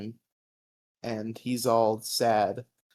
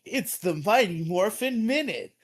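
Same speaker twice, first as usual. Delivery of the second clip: higher, hammed-up promo narrator voice; TikTok narrator guy; 6 semitones higher, 3 dB louder than usual. Mocking a TikTok narrator to a friend, hammed-up promo voice. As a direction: announce it like a cheesy TikTok explainer. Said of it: "a TikTok narrator guy being like".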